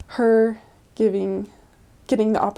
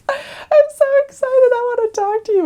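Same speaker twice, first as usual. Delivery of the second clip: much higher, louder squeal of delight; excited old lady; 13 semitones higher, 6 dB louder than usual. Retold or imitated. Old woman greeting missionaries at her door, delighted squeal. Neva voiced happily.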